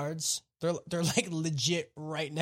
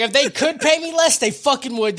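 put-on jaded old guard; 6 semitones higher, 7 dB louder than usual. Voicing the indifferent old guard.

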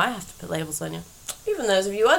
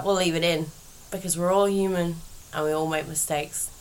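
ordinary talk

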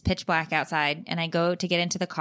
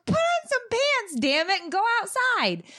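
exasperated order; 13 semitones higher than usual. Retold or imitated.

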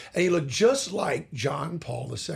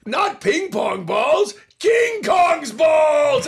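slow, high, very loud comic yell; shouted punchline; 11 semitones higher, 7 dB louder, much slower than usual. Retold or imitated. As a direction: bellow the punchline at the Pope. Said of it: "and I go".

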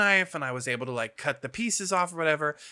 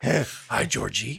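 Pennywise impression; much slower than usual. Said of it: "What's a Pennywise quote?".

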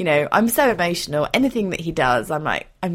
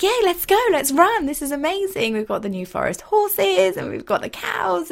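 high, bouncy horse voice; playful high-pitched voicing of an eager horse; excited horse voice; 10 semitones higher than usual. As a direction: voice the horse as if he's thrilled to run. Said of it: "he's like".

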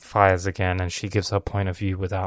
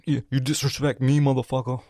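higher, louder mock outrage; 5 semitones higher, 4 dB louder than usual. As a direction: rise up on "motherfucker" like a riled relative. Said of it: "that's like".

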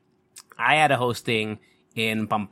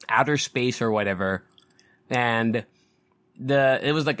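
ordinary talk, nothing marked

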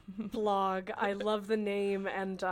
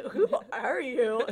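louder, laughing; 3 semitones higher, 7 dB louder than usual. Real, not acted. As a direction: repeat it through a laugh. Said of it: "Carly, who are you?".

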